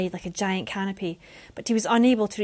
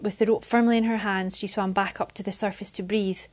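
ordinary delivery, nothing marked

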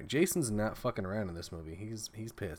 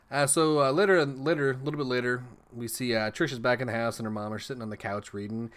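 ordinary delivery

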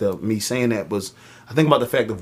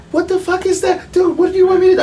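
much higher, panicked squeal; panicked friend; 5 dB louder, faster than usual. Retold or imitated. Friend panicking when told to grab the arm console, high squeal. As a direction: play the friend freaking out in the car. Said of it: "so he goes".

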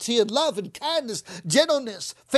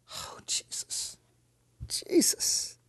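soft high whispered Jesus, repeated; whispered prayer; 9 dB quieter, slower than usual. Real, not acted. Preacher whispering prayer before the congregation. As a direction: breathe his name over and over.

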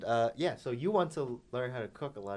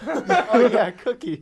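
laughing it out high and loud; 13 semitones higher, 16 dB louder, much slower than usual. Real, not acted. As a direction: blurt through a big laugh. Bursting out laughing at answer.

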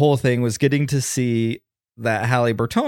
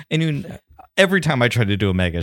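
ordinary talk, nothing marked

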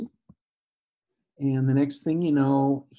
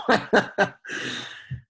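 breathy laugh; 8 semitones higher than usual. Real, not acted. Laughs at the phone mix-up.

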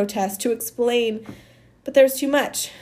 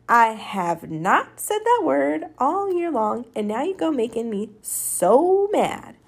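slow, emphatic, higher-pitched reproach; exasperated scolding; 5 semitones higher, slower than usual. Acted. Amanda blames Maniac for making her say it, slow exasperated scolding. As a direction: drag out each word in exasperation.

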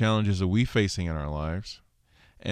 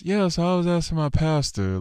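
high, shifted voice for the coworker; voicing coworker; 9 semitones higher, 3 dB louder than usual. Coworker telling his question, high put-on voice. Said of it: "So he goes".

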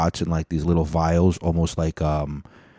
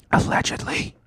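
whispered aside. Shown as a demonstration. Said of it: "throw that allegedly in there".

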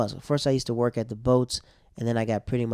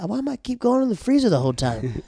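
mock whine; 11 semitones higher than usual. Whining at being sent to freezer.

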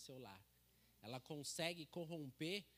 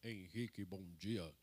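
much lower, imitating a coworker's very deep voice; imitated deep morning greeting; 9 semitones lower, 3 dB louder than usual. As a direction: drop way down like the big-voiced colleague at work. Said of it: "ele tem uma voz super grossa".

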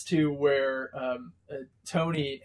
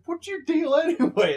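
higher, louder exasperated question; Hawkeye scolding; 14 semitones higher, 6 dB louder than usual. Retold or imitated. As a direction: demand an answer from the brawlers. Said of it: "he's like".

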